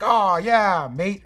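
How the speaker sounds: hammed-up British bloke saying mate; friendly Londoner in a clumsy mock British accent; bad British accent. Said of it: "I can't do a fucking British accent".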